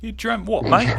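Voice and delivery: high, teasing British "mate" voice; mock British accent. Teasing the co-host about a dream, mock British accent. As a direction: put on a British questioning voice.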